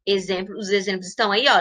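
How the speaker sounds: normal talking voice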